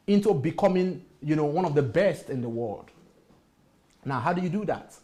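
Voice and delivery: ordinary delivery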